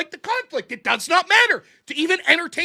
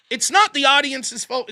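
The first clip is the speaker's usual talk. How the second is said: normal talking voice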